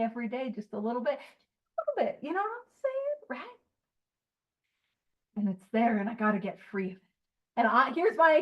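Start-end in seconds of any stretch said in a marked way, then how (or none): 1.2-3.6 much higher, softer squeak; mock tiny voice. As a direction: make it sound tiny and nagging.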